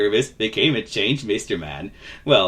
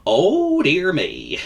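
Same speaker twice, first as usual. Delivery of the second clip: slow, higher, concerned drawl; kindly vagabond; 7 semitones higher, much slower than usual. Acted. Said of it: "he was a kindly vagabond".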